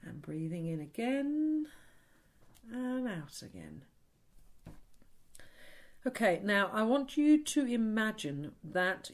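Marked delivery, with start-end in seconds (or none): 0.0-3.8 guided breathing. Shown as a demonstration. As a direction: ease them through the breath, slowly.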